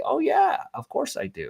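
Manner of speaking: high, bright lift on oh yeah, then settling; light imitation of a sweet old grandma; sweet old lady. Grandma on the phone proudly answering the salesman, sweetly. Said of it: "sweet southern voice".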